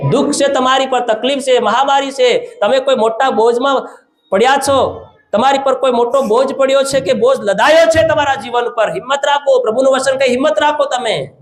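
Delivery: high, insistent exhortation sustained phrase after phrase; forceful preaching. Real, not acted. Preacher telling burdened, suffering people to take courage, forceful preaching.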